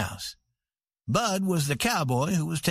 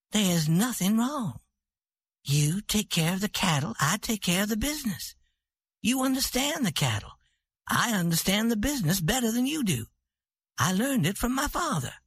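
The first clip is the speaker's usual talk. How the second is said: higher woman's voice, patient but superior; proud ranch queen; 6 semitones higher than usual. A narrator's reading. Santa explaining the business to her husband, patient and superior. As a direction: lay it out as if to a child.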